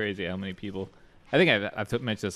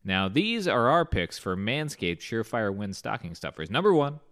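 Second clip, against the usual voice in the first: upbeat, scripted sponsor pitch; podcast ad read; 4 dB louder than usual. Acted. Host pitches holiday gifts for the sponsor, ad-read delivery. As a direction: sell it with swinging, punchy emphasis.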